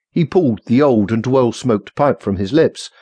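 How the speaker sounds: ordinary delivery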